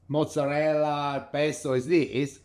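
ordinary talk, nothing marked

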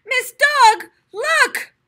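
put-on high, loud children's call; excited cry. A narrator's reading. Children calling their teacher, high loud cry. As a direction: shout for the teacher. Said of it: "cried the children".

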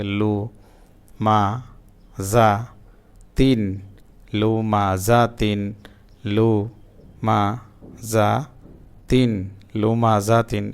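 syllables split apart with pauses, then joined; broken syllable reading. Shown as a demonstration. Teacher showing learners broken-up syllable reading, slow and separated. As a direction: read it piece by piece, then blend the word. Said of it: "জবর জের পেশ মিলিয়ে দেখতে পারি".

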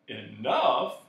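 loud, impatient echo. A narrator's reading. Magician answering Milo's question, impatient. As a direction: throw the word back impatiently. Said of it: "he said impatiently".

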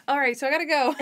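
giggling, voice rising into laughter; can't hold the retold line without laughing; laughing through the line. Retelling Natalie's escape, laughing. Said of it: "And he's like".

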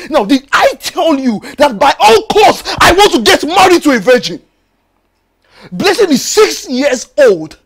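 crying angry shout. Acted. Betrayed husband yelling at his wife through tears.

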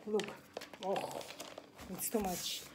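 ordinary talk, nothing marked

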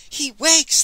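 high, loud shriek at full lungs. A narrator's reading. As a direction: shriek it out in excitement. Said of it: "they shrieked at the tops of their lungs".

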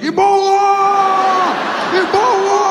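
high, loud alarmed shout; panicked yell. Retold or imitated. Passenger across the aisle shrieks at a cough, high panicked shout.

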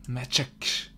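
breathless whisper of the word. Real, not acted. Gamer whispers the word magic with a laugh.

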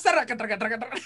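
rhythmic traca traca like keys being hammered; playful typing sound effect; imitated typing clatter. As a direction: mimic someone pounding a keyboard.

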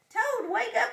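high, loud wake-up call; crying out. A narrator's reading. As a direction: call out to rouse a sleeper. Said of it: "he cried".

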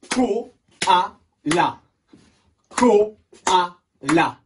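loud "Koala" clapped out in three beats; syllable by syllable. Shown as a demonstration. Teacher shows pupils the clapping, syllable by syllable. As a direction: punch out Ko, a, la with gaps in "Koala". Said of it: "On va le taper".

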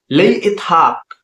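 drawn-out demonstration for learners; slow model. Shown as a demonstration. Teacher models phrase slowly first.

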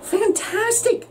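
high, slow gushing praise; mock amazed. A narrator's reading. Princess buttering up the dragon, high slow fake awe. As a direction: gush over it with fake wonder.

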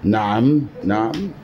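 low, earnest documentary host; mock formal interviewer. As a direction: quiz him like a TV presenter.

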